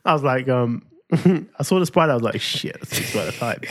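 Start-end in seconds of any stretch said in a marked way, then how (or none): 2.4-3.7 slow, hushed whisper of alarm; startled whisper. Retold or imitated. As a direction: breathe it out at the spider. Retold startled whisper at spider.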